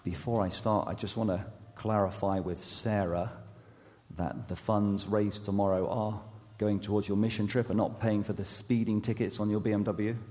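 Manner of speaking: ordinary talk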